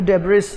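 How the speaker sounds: debris with the s sounded; wrong pronunciation. Shown as a demonstration. Teacher showing how learners might say it, debris with final s. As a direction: say debris as "deb-riss". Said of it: "you might pronounce".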